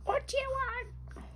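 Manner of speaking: high, strained mum voice; harassed mum. Acted. Mum snapping at the kid in the back, high and strained. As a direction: squeak it like a frazzled mother. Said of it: "a woman going".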